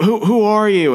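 high, alarmed who-are-you; startled. Retold or imitated. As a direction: jump at the stranger in the suit. James startled by masked stranger.

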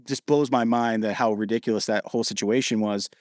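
ordinary talk, nothing marked

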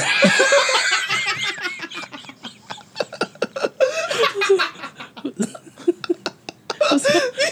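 high roaring laughter; long shrieking laugh. Real, not acted. Storyteller losing it over his own prank, shrieking laugh. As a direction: howl with laughter at the memory.

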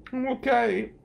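high, tearful "I'm okay"; teary answer. Retold or imitated. Weepy lawyer answering at the ice cream freezer, tearful. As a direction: say it like you're barely holding it together.

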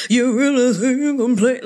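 same sassy challenge, said again. Pretend. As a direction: repeat the dare with attitude. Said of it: "Let me repeat it one more time".

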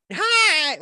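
high, pinched little nasal "Hi"; nasal mom voice. Retold or imitated. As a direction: squeak it out through the nose. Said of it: "nasally, uh, voice".

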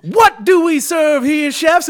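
high, loud, slow interrogating shout; head chef quizzing his kitchen staff; drill-sergeant chef. Pretend. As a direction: bark the question at the line cooks. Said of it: "he goes".

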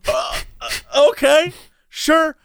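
high, slow, hesitant stammer; stall, then agree high and unsure; awkward agreement. Retold or imitated. Passenger answering her heartbreak confession, awkwardly.

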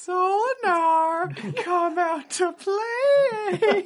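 very high, very slow falsetto call; teasing Solinar, creepy high singsong call; creepy singsong taunt. Acted. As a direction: lure him out like a horror villain.

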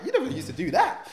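high, teasing disbelief; mock disbelief. To his mum, a high mock disbelieving quote. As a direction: tease mum as if stunned. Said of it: "I'm looking at my mum like".

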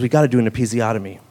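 lightly voiced farmhand, quick and low; matter-of-fact [name]. [name] announces the procedure.

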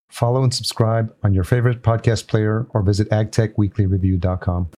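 loud, polished sponsor read; punchy promo voiceover with crisp emphasis; podcast ad read. Real, not acted. Host reading conference and podcast promos to listeners, ad read.

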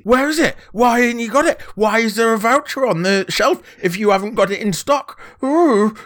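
high, loud posh brat whining; posh mardy child. Pretend. Co-host mocking his younger self at the till, posh whiny voice. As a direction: throw a posh child's tantrum. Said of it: "put on a little posh voice".

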